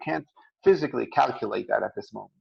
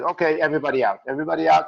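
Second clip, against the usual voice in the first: loud call to clear the shop, higher and louder; calling everyone out; 5 semitones higher, 7 dB louder, faster than usual. Retold or imitated. Butcher ordering his customers out of the shop, loud call. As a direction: bark it out to the whole store.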